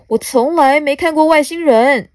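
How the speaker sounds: high, loud wonder; amazed little bug. Acted. Small bug has never seen an alien, amazed. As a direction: marvel as a storybook bug.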